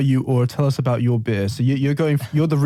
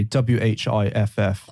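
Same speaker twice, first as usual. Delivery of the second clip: slow, letter by letter spelling; spelled out. Shown as a demonstration. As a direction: spell whiff out slowly, one letter at a time. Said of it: "A whiff, you can say".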